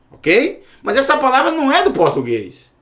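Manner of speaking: normal talking voice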